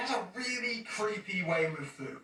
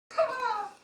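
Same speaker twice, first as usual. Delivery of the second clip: high burst of laughter; 5 dB louder than usual. Real, not acted. Laughing at the name.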